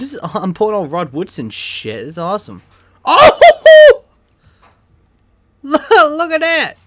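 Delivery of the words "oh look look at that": laughing, high and loud; excited shout. Real, not acted. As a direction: shout with delight at the pull. Rare pull in pack opening.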